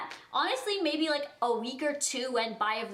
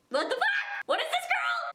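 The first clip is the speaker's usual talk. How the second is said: shocked disbelief. Retold or imitated. Shocked at classmate's phone.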